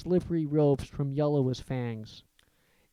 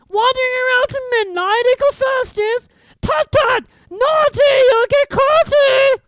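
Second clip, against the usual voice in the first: very high, loud taunting squeal; cackling sprite, far higher and louder; mischievous poltergeist; 12 dB louder than usual. A narrator's reading. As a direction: tease them like a gleeful imp. Peeves taunting the children.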